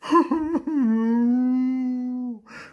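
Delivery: long, loud, high wail; hammed-up drawn-out crying; howling sob. A narrator's reading. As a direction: bawl like a frightened little ghost. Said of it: "fing Bibi an zu heulen".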